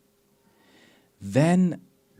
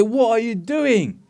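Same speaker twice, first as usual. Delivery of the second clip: mock shout; 8 semitones higher than usual. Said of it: "you're gonna shout".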